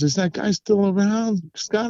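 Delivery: put-on an old bandmate wondering aloud, drawn out; quoting the trumpet player. Trumpet player asks if Scott is still around, high and slow.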